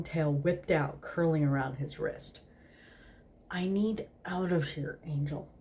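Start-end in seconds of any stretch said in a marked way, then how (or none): none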